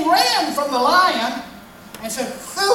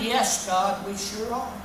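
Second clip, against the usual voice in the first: much lower voice answering God; smug worshippers; 5 semitones lower, 6 dB quieter, slower than usual. Acted. People answering God, lower and self-satisfied.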